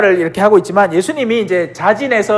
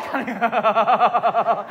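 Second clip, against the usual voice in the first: laugh; 8 dB quieter than usual. Real, not acted. Priest laughs at his own Peter impression, soft laughter. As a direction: burst of real laughter.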